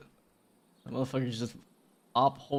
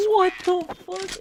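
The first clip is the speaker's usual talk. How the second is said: high, loud disbelieving outburst; shocked; 5 dB louder than usual. Real, not acted. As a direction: blurt it out in shock. Enemy does strange crouch walk.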